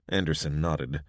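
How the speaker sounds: plain reading voice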